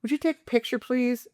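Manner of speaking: high-pitched imitation of a wealthy girlfriend; sweet-talking request. Girlfriend asking him for a picture, high put-on sweetness. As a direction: mimic her cooing a request, pitched well up.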